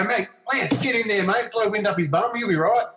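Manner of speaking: high, bouncy mock encouragement; cocky pep talk. Cocky pep talk to nervous teammate.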